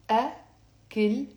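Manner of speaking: أكلت split into "ak, kil, te"; أكلت syllable by syllable for repeating; T pronounced, by syllables. Shown as a demonstration. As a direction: sound each syllable of أكلت, clear T in "te". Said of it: "notice I'm pronouncing the t".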